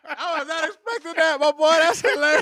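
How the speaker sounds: high, loud laughing delight; real laughter, can't stop. Real, not acted. Host cracking up at the joke.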